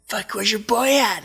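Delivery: high, loud imitation of a worked-up competitor; heated mimicry. Andy asking after his rival in France, heated high mimicry. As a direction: demand it in a high, loud mock rant.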